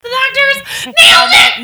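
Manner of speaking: high, slow gleeful yell; triumphant shout. Real, not acted. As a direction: crow it like a victory. Triumphant pun claim.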